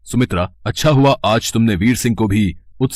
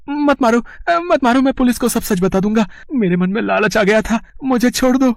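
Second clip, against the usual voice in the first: much higher, desperate begging; terrified pleading; 13 semitones higher than usual. Acted. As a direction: beg for mercy as the blows land. Begging the unseen beater to stop.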